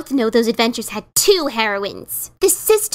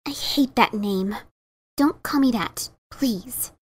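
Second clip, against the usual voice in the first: low, hurt plea; 4 semitones lower, 6 dB quieter than usual. Acted. Hurt by the nickname.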